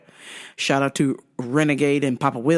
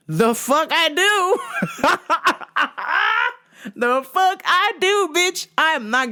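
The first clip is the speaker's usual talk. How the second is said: high, drawn-out mock outrage; much higher, slow, with a laugh in it; incredulous refusal; 11 semitones higher, slower than usual.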